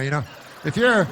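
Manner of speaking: normal talking voice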